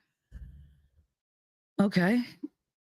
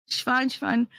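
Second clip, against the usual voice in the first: dismissive staff; 9 semitones higher than usual. Retold or imitated. Mimics staff brushing her off.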